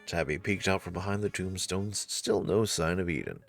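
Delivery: usual speaking voice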